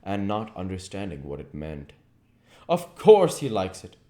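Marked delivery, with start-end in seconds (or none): 2.7-3.9 lightly voiced amused lord; breezy aristocrat, higher and louder; a hint of an idle dandy; Lord Henry. A narrator's reading. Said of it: "said Lord Henry".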